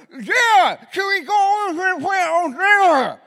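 loud, high and very slow through a mouthful of gauze. Retold or imitated. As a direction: shout it slowly through stuffed cheeks. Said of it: "I'll just say it louder".

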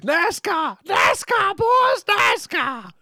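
very high, drawn-out mock yell; ring announcer's yell. Mimicking the man introducing the interview, high drawn-out shouting. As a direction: bellow it like an over-hyped ring announcer. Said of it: "Borash introduces".

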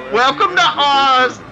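high, drawn-out mock announcer cry; imitating a costumed greeter's repeated high call; masked old man. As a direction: belt it out high and slow, mimicking the old man. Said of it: "you keep hearing him say".

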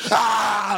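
loud, drawn-out Exorcist-style yell; imitated angry roar of pouring water; high, loud comic scream; mock gushing scream.

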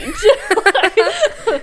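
bursting into high laughter; big squealing laugh; loud high laugh. Real, not acted. Laughing after joke.

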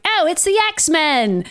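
put-on high, drawn-out Australian accent; hammed-up Australian exclamation; Pyro. Pyro spotting the heroes, imitated Australian accent. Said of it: "Pyro has an Australian accent, which is not very well done".

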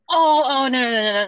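loud, quick rush of no's; emphatic denial. Real, not acted. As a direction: jump in to stop her worrying. Reassuring friend, quick denial.

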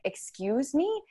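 soft, high rising question; retold incredulous "excuse me"; indignant disbelief.

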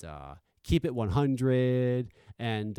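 ordinary talk, nothing marked

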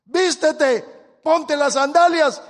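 high, loud angelic order; loud command. Retold or imitated. Angel ordering Pedro to dress, loud high command. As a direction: ring it out like a summons.

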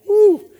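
short, high, loud woo; excited whoop. Acted. Preacher getting excited about canning, high whoop.

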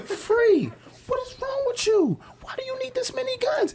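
very high falsetto disbelief; incredulous questioning. Retold or imitated. Questioning a friend about his guns, incredulous falsetto. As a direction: squeak out a mock reproach. Retelling his baffled reproach.

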